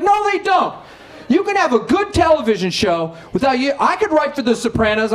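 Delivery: high, defensive fatherly insistence; mock-offended father; indignant dad. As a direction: insist like a proud dad who knows better. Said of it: "He's like".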